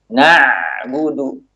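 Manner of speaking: very slow "Na'budu", the ain flowing briefly; slight flow on ain. Shown as a demonstration. Teacher demonstrating the ain for students, slowly. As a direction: let the ain of "Na'budu" flow just a little. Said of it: "namun sedikit".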